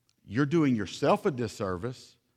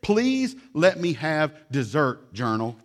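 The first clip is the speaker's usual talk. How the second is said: put-on slow, louder begging kid; mock pleading child; 3 semitones higher, 4 dB louder, slower than usual. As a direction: beg for dessert like a little kid. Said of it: "Let me read you a letter".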